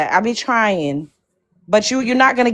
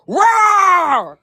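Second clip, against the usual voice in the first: high, shouted roar with a cry in it; roaring to end an angry rant; furious roar; 8 semitones higher than usual. Real, not acted. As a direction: let out a raging roar.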